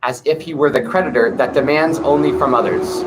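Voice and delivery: put-on calm, measured recitation of an old text; scripture reading. Reading the Tao Te Ching aloud to viewers, calm measured recitation.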